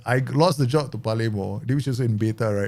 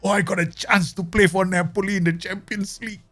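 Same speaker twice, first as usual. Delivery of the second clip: naive player excitement; 9 semitones higher than usual. Retold or imitated. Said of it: "He doesn't know that yet".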